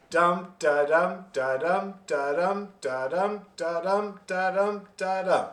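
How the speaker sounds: lilting dum-da hornpipe swing, long-short; counting out the swing on syllables; swing rhythm vocalised. Shown as a demonstration. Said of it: "it ends up being like this".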